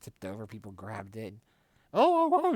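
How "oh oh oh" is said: much higher, louder mock panic; alarmed. Mom after nearly tipping the table, high panicked yelps. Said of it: "she was like".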